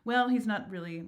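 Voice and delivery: ordinary delivery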